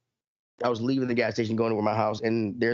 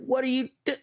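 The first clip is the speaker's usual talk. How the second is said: high, incredulous question cut off by a laugh; mock disbelief; 13 semitones higher than usual. Imitating himself at a stopped driver, cut-off high question. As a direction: start high and baffled, then break off laughing. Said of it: "I'm like".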